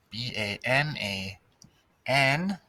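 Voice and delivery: "B A N A N" spelled out one letter at a time. Shown as a demonstration. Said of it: "spelling banana".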